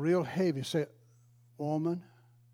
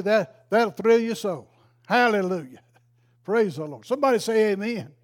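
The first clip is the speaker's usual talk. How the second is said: rousing praise; 4 semitones higher, 7 dB louder than usual. Real, not acted. Preacher rousing the congregation.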